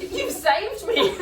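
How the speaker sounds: high, loud, slow laughing thanks; laughing gush. Real, not acted. Gushing thanks to the coach.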